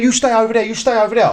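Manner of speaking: firm, high retold command; retold order. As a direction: act out keeping someone back. Retold officer ordering people apart.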